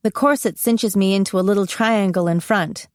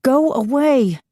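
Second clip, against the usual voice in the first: higher, desperate inner plea; 6 semitones higher, slower than usual. A narrator's reading. Narrator pleading in thought with the vision, desperate. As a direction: beg it silently to leave you. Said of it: "I think at the vision desperately".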